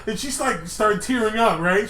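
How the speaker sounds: ordinary talk, nothing marked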